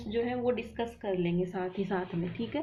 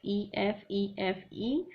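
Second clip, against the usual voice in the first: ordinary delivery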